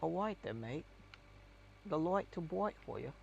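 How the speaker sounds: lightly put-on cockney English accent. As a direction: say it as a casual London cockney, "light" as "loight". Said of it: "the other type of English that's like".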